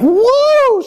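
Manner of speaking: high, delighted woo; a big excited woo; excited whoop. Retold or imitated. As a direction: whoop like a thrilled wife. Husband voiced excited about trash.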